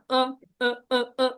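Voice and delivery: "অ" as short repeated "uh" sounds; how many say it. Shown as a demonstration. Teacher shows how many people say it, short repeated "অ". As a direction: say "অ" in short separate bursts. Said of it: "অনেকে এরকম বলে না".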